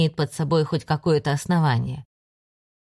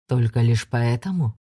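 low doubting question; 6 semitones lower than usual. Acted. Ander probes, low.

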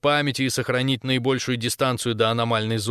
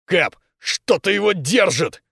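raised, slow straining growl; growled alarm; 5 semitones higher, 4 dB louder, slower than usual. A narrator's reading. Ramirez hauling on the cable, growling to the captain. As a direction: growl it out with effort. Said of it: "прорычал Рамирес".

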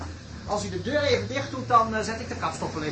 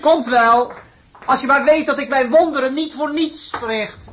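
grand boast; 5 semitones higher, 6 dB louder than usual. Acted. Boasts as professor.

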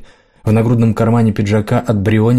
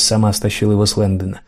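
ordinary delivery, nothing marked

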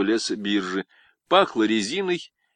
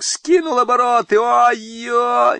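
very high, loud hoarse-edged yell; straining shout; 13 semitones higher, 7 dB louder, slower than usual. A narrator's reading. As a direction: shout it over the rotor noise. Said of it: "надсаживаясь, кричал Мещук".

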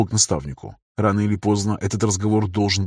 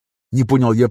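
ordinary delivery, nothing marked

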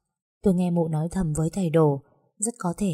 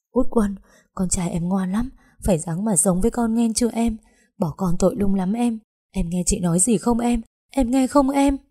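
desperate pleading; 3 semitones higher than usual. Acted. Aunt begs dying woman.

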